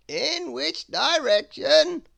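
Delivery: high, loud, slow theatrical wizard. Acted. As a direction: savour every grand word like an eccentric wizard. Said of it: "asked the wizard".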